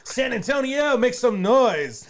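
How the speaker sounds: booming Tejano radio MC, high and loud; hype MC. Shown as a demonstration. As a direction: pump up the crowd like a live emcee. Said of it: "I'm saying that because I used to be a M C".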